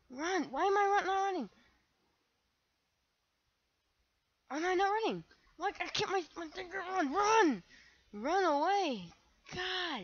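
high, loud frantic yelling; panicked shouting. Real, not acted. Gamer shouting at himself to run, high and frantic. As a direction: scream at your own stuck character.

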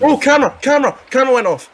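high, loud excited yelling; excited shout. Real, not acted. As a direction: blurt it out in a rush of alarm. Camera trap triggers suddenly.

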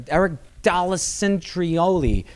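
"Dall'Acentrioli" garbled slowly in a high announcing voice; botched name announcement. Retold or imitated. Announcers introducing a comedian, botching "Dall'Acentrioli" slowly and high. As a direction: stretch "Dall'Acentrioli" out high, adding wrong syllables. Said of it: "but everybody".

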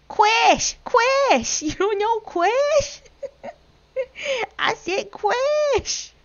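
put-on high, loud, incredulous baby-talk squeal; mock outrage. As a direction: squeal it in scandalised disbelief, laughing. Mock outrage at not knowing someone.

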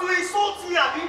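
loud, high, offended; indignant outburst. Acted. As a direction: throw the accusation back. Wife feeling insulted by friends.

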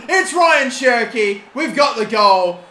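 loud, slow triumphant announcement; real joyful goal call; goal celebration. Real, not acted. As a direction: proclaim the scorer to everyone.

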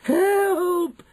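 very high, loud sustained scream; piercing cry of panic; screamed help. A narrator's reading. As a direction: shriek it in terror. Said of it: "screamed Missus Jenkins".

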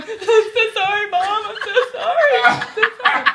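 high, loud hammed-up wail; mock sobbing. Mom apologizing to Nana while vacuuming her up, mock sobbing. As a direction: imitate a sobbing, repeated apology. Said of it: "she's sobbing, running the Hoover, going".

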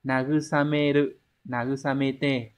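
"nagusameru, nagusamete" read slowly, one syllable at a time. Shown as a demonstration. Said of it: "Vậy thì nó phải đọc là". (đọc chậm "nagusameru, nagusamete")

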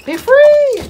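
high, loud joyful cry; triumphant shout. Real, not acted. Owner setting her dog loose after the bath, loud joyful shout. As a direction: let him loose with a whoop.

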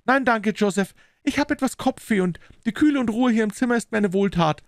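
very high female character voice; story figure of young woman. Acted. Sister declines her brother's invitation, high young-woman voice.

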